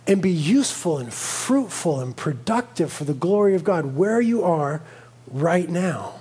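low, soft and tender; gentle fatherly voice. Retold or imitated. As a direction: reassure a restless child calmly. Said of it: "And the Father says".

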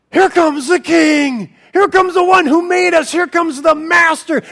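awed mountains. Acted. Mountains voiced recognizing the King.

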